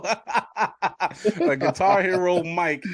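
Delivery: loud, high-pitched amusement, breaking into laughter; amused and incredulous, laughing through it; laughing disbelief. Real, not acted. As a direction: crack up while repeating it, drawing out the words. Amused host repeats guest's makeshift setup.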